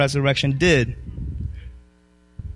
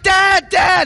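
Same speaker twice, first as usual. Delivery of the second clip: put-on higher, louder cry of a struggling boy; son calling for dad; 14 semitones higher, 6 dB louder than usual. Retelling his drowning son's cry, mock panicked call. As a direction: call out like a child in the water. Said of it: "he's screaming out".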